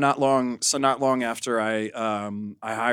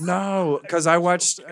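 higher and louder, jumping in; eager objection; 8 semitones higher, 6 dB louder, slower than usual. Real, not acted. Objecting to the egg claim, eager and louder. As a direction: burst in to correct them.

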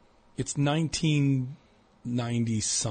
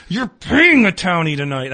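loud, raised, drawn-out demand; retold angry threat; 6 semitones higher, 9 dB louder than usual. Townie retelling a threat to the club guy, angry and loud. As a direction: act out throttling him as you demand payment.

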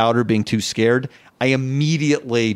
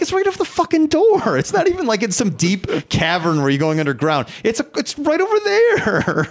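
high exasperated whine; 9 semitones higher than usual. Retold or imitated. Complaining, much higher.

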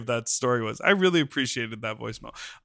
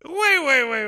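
sharply higher, louder cry of surprise; real startled call; stunned wait; 10 semitones higher, 4 dB louder than usual. Real, not acted. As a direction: stop everything, can't believe it. Stunned by the accent reveal.

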